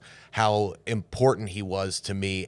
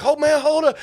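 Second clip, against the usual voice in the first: much higher, loud, flustered plea; mock alarmed; 9 dB louder than usual. Damon pleading to be let go, loud mock alarm. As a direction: squawk it like you're being crushed. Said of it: "he's like".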